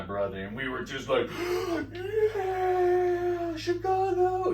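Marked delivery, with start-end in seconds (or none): 1.3-2.3 excited gasp. Retold or imitated. Fans react to the wrestler. 2.4-4.5 high, loud fan cheer; excited cheer. Retold or imitated. Two brothers cheer for their hometown at the wrestler, an excited high yell.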